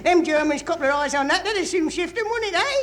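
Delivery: put-on broad Cockney accent, cheeky and jokey; Cockney private. Cockney soldier in the trenches joking about his mother-in-law in Cockney. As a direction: lay on the Cockney banter. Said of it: "the colourful Cockney humour".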